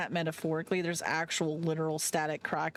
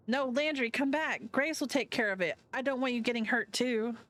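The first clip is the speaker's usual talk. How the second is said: pleading Avery; 7 semitones higher than usual. Acted.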